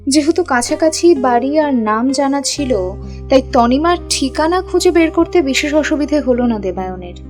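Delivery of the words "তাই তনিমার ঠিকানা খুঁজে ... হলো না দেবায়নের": usual speaking voice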